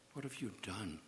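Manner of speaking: soft, sorrowing accusation; quiet grieving reproach; hushed. Acted. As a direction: ask it almost under the breath.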